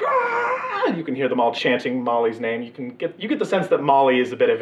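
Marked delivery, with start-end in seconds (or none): 0.1-0.9 crowd roar. Acted. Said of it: "crowd is just like".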